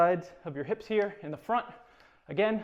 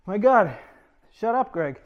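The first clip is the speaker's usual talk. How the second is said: embarrassed outburst; 4 semitones higher, 8 dB louder than usual. Real, not acted. Scolds himself, embarrassed outburst.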